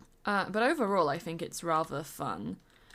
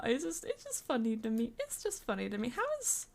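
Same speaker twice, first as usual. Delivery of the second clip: usual speaking voice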